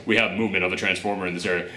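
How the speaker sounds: low, quiet, fast radio report; a light voicing of a government soldier. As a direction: rattle it off like a radio operator.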